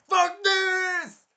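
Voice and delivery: high, loud mock shout; sudden furious yell at the court; angry outburst. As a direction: yell it like he's had enough. Hero snaps at the king.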